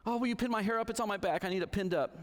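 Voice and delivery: put-on a child confessing fear at bedtime; little daughter. Daughter voiced in bedtime story.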